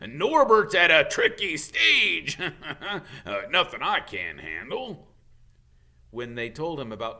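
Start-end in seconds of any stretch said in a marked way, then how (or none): 0.1-2.4 much higher, loud and slow; proud but frazzled giant; Hagrid, strained. Acted. Hagrid tells kids Norbert is tricky, high loud strained. 2.4-3.2 short nervous laugh; nervous chuckle. Acted. Hagrid laughs off the dragon trouble, nervous chuckle. 3.3-5.1 chuckling through it; laughing Hagrid. Acted. Hagrid reassures kids while laughing.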